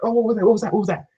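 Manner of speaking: a hint of hammed-up paranoia; mock paranoid. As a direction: play a jittery gun owner startled by the coffee maker.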